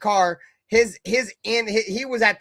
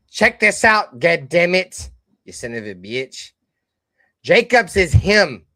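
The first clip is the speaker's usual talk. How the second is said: retold loud taunt; 5 dB louder, slower than usual. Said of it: "and I said this".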